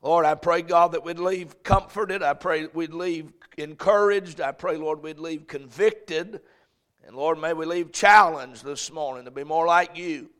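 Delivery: fervent pastor's prayer. Acted. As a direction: pray over the congregation. Leads congregational prayer.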